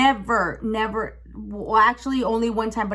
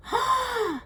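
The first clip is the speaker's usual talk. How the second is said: sharp, high gasp; one sudden intake of breath; shocked gasp; 14 semitones higher, 3 dB quieter than usual. Acted. Teller reliving what she saw, shocked gasp. Acts out seeing the scene.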